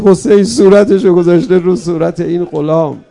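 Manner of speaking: loud, rising sermon climax; impassioned cry. Acted. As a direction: pour it out loud to the mourners. Climax of the martyr story.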